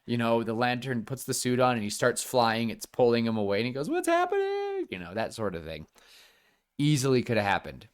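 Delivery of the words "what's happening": high panicked squeal; panicked cry. Retold or imitated. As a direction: squeak it as you're pulled away. Hal yanked away, panicking.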